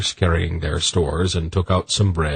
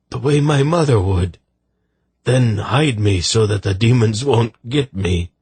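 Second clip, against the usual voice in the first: higher, pleading and distraught; desperate soldier; 6 semitones higher, 4 dB louder than usual. Acted. Soldier begging the lady to kill him gently, desperate. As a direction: beg with desperate longing for release.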